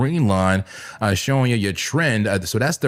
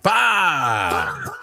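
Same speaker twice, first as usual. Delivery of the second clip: catchphrase shout. Real, not acted. Streamer yells his catchphrase at the live chart.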